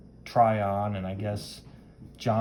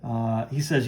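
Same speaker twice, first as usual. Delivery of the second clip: ordinary delivery, nothing marked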